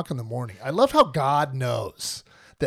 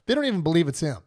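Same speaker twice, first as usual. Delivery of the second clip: normal talking voice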